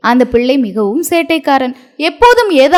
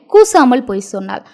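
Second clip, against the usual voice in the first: plain reading voice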